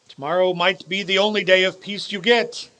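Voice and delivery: ordinary delivery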